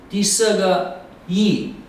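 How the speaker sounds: 一 dropping sharply from high, slowly; fourth tone. Shown as a demonstration. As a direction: snap 一 down from the top. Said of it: "第四个声调是一".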